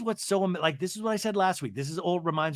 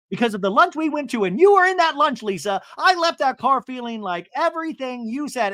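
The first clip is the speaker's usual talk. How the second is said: higher, louder mock confrontation; heated accusation; 8 semitones higher, 7 dB louder than usual. Recapper as Heather confronting Lisa over lunch, heated and loud. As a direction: pile on the accusation, pitched up and loud.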